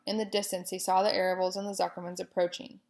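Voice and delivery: ordinary delivery, nothing marked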